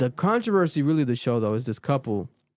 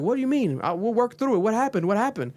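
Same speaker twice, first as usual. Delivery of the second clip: pleading; 7 semitones higher than usual. Retold or imitated. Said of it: "And she's like".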